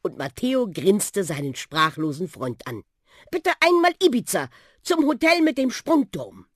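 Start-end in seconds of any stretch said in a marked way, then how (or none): 3.3-6.5 put-on high, loud, eager boy; excited order. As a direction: announce the destination excitedly. Boy orders trip to flying bus. (begeisterte Bestellung, hoch und laut)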